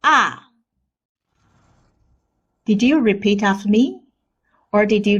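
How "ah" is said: "Ah" starting high and sliding sharply down; falling tone. Shown as a demonstration. Teacher demonstrates fourth tone, swooping falling ah. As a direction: drop "Ah" from your highest note. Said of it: "then slide down".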